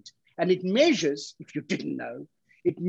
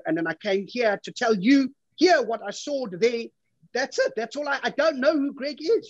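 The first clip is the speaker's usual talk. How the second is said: exasperated; 7 semitones higher than usual. Acted. Defending his story to girlfriend.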